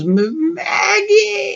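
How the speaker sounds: desperate high scream of her name; screaming Maggie's name. Retold or imitated. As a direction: shriek her name as if she were being torn away. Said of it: "He just screams Maggie's name".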